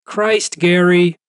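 high call from another room; wife calling from the hallway, exasperated. Acted. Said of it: "she called from the front hallway".

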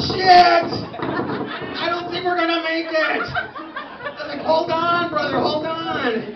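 straining stretch-pants threads. Acted. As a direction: yell like threads about to snap.

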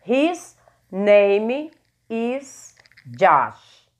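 very slow, each word drawn out; slow pronunciation model. Shown as a demonstration. Teacher drills pronunciation for students, very slowly. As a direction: stretch His name is Josh out for the class. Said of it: "Ok a pronúncia aí?".

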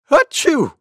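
high-pitched monkey sneeze; a sharp, high sneeze. Acted. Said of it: "went Monkey".

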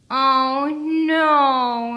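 high loud wail of dismay. A narrator's reading. Teddy wails over the snapped tree, loud and high. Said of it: "he wailed".

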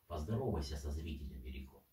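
ordinary talk, nothing marked

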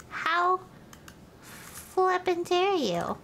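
drawn-out indignant how dare you; slow mock outrage. Streamer mock-outraged at a rude sim, slow.

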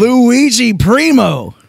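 high, loud, drawn-out name call; announcer shout. Acted. Announcer introducing the pizza wrestler, big hyped shout. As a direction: belt out the name slowly.

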